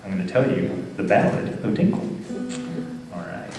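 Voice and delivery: ordinary delivery, nothing marked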